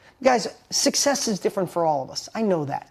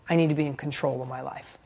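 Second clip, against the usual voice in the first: tearful confession; 6 semitones lower, 6 dB quieter than usual. Retold or imitated. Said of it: "full of tears running down my face".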